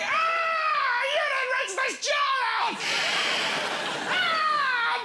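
very high, loud shrieking scold; furious fox. Acted. Fox telling off the homeowner about recycling, shrieking. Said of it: "And they're going".

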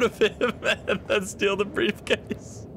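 high, slow, giggling delivery; laughing through words. Real, not acted. Player telling a silly robbery plan, laughing through words.

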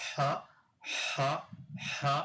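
"হা" as an isolated English h; h sound demo. Shown as a demonstration. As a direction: breathe out each "হা" with a pause between. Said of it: "এটার উচ্চারণ হচ্ছে". ("হা" হ ধ্বনি হিসেবে আলাদা করে উচ্চারণ)

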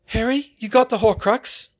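high, loud questioning character; urgent high-pitched query; Hermione's voice. A narrator's reading. Hermione asks Harry, voiced by narrator.

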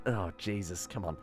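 ordinary talk, nothing marked

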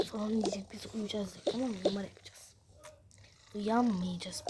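hushed conspiratorial whisper. Acted. Whispering the surprise plan to her partner, hushed. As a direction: whisper the plan so nobody wakes.

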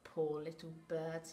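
mild smirk in the voice, as a sly fox. Acted. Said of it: "he smirked".

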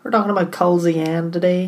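put-on a terrible American accent on Culzean; teasing a co-host with a bad American accent. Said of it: "That's a terrible accent".

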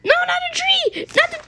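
high panicked squeal; playful panic. Pretend. Player heading into a tree, playful panic. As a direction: squeal as the crash comes.

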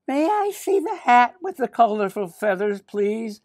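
put-on high little-girl voice; Millie. Millie asks the shop man for a hat, high girlish voice. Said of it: "she asked the man behind the counter".

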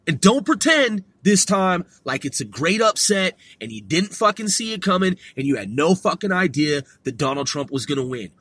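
ordinary delivery

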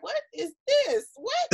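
high, drawn-out incredulous question; baffled disbelief. Retold or imitated. Quoting her boyfriend watching the show, high-pitched bafflement. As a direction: mimic someone baffled, rising on the last word.

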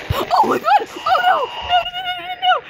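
high, loud screams of no and get away; terrified screaming. Real, not acted. Girl shrieks at Slender Man to get away. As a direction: shriek in real terror as he catches you.

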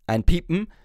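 usual speaking voice

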